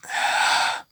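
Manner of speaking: acted breathy sigh; soft, resigned exhale; reluctant sigh. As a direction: sigh as if giving in. Friends reluctantly leave decision to leader.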